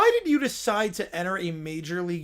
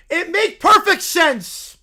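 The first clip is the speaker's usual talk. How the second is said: higher, louder, slow dim bear shout; 8 semitones higher, 8 dB louder, slower than usual. Acted. Little Brown Bear shouting about romance, slow and loud. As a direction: bellow it like a lovesick bear.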